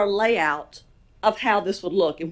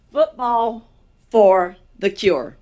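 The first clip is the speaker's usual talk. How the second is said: Football for the Cure, slow and loud with each word spaced; slow emphatic title; 7 dB louder, much slower than usual. Real, not acted. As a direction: announce Football for the Cure slowly, hitting for. Announces the event name.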